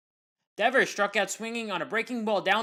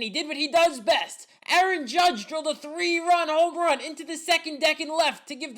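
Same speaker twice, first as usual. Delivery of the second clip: excited home run call; 7 semitones higher than usual. Real, not acted. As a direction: sell the big swing with real excitement.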